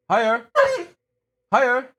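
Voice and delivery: very high, loud single word; high coaxing. Shown as a demonstration. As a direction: pitch it right up to show the dog.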